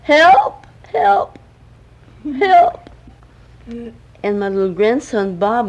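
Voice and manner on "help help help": loud, high cries for help; hollering for help. Acted. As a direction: shout up from the bottom of the pool. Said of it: "he started to holler".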